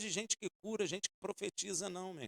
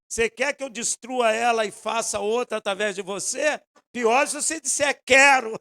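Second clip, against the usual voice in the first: loud, rising mock divine question; a hammed-up God speaking; God voiced; 6 semitones higher, 13 dB louder than usual. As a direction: act out God's offer with gusto. God voiced in retold story.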